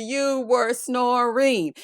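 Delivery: "You were snoring" stretched very slowly, word by word; slow, over-enunciated playful accusation; drawn-out emphasis. Retold or imitated. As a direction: drag out "snoring" syllable by syllable. Repeats the tease word by word.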